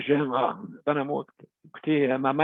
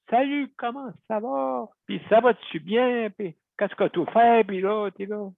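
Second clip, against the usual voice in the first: cheery Acadian phone chatter, pitched higher; imitating a chatty Acadian teller, pitched up; Acadian accent; 6 semitones higher than usual. Host imitates friendly Acadian bank staff on phone, light Acadian accent. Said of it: "avec le petit accent acadien".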